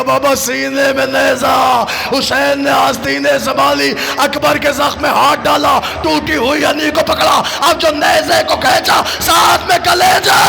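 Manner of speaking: high, loud grieving chant of the tale; wailing lament recitation. Acted. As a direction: cry the scene out to the mourners. (ऊँची आवाज़ में मातमी मरसिया ख़्वानी)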